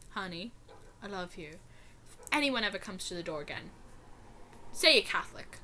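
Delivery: mother gently advising her daughter, quiet and tender; soft motherly. Retold or imitated. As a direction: say it gently like a mom soothing her kid. Mom voiced softly.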